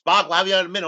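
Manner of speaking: high, loud call to a friend; imitating a hurried shout to the next cell. Kirk calls out to Spock.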